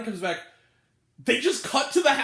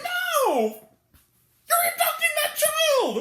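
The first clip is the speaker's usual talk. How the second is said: high, loud comic protest; horrified shout; 5 dB louder, slower than usual. Real, not acted. Critic protesting to abductor in the movie, horrified high shout. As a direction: yell it high in outraged disbelief.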